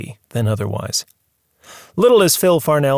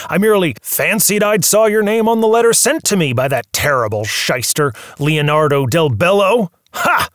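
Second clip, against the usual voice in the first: put-on higher, agitated old mogul ranting; angry old tycoon; 7 semitones higher, 3 dB louder than usual. Movie magnate accuses his visitor of conspiracy, raised and agitated. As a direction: rail at him like a wronged movie boss.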